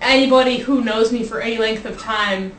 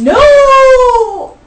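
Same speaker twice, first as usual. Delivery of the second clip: loud, high cry of no; loud protest; 9 semitones higher, 8 dB louder than usual. Real, not acted. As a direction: yelp out a horrified no. Protests friends seeing video.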